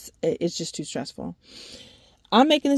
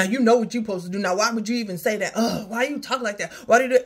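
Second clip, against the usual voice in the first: ordinary talk, nothing marked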